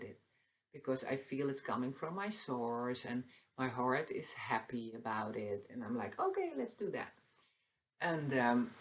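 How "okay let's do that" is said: high, lilting okay; playful high-pitched self-quote; cheerful agreement. Retold or imitated. Voicing eager self agreeing to follow heart.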